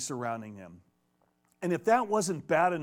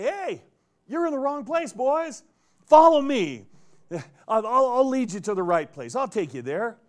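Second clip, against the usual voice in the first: much higher and louder, a cheery guide hailing lost men; hearty stranger calling out; 8 semitones higher, 8 dB louder than usual. Retold or imitated. Stranger calling blinded soldiers to follow him, high and loud. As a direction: call them over like a friendly stranger who knows the way.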